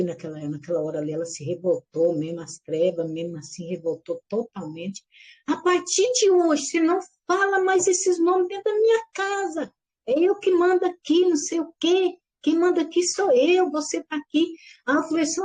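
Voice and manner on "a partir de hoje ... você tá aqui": mother's angry order. Retold or imitated. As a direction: lay down the law like an irritated mother. Angry mother forbidding names at home.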